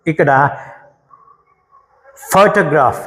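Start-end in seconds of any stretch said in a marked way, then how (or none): 2.3-3.0 photograph with a strong ఫో, weak remaining syllables; stress on first syllable. Shown as a demonstration. Teacher modelling for students, first syllable of photograph stressed. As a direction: punch the opening ఫో of photograph. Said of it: "folk stress ఉంది".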